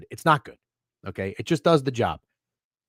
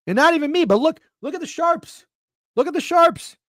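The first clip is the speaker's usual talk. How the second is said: worked-up rant; 10 semitones higher than usual. Real, not acted. Heated rant climax.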